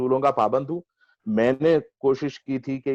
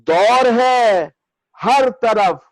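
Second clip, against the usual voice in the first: measured, higher mushaira declamation with lengthened vowels; ghazal recitation; 6 semitones higher, 5 dB louder, much slower than usual. Acted. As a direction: recite the verse grandly, drawing out each phrase.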